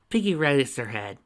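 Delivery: usual speaking voice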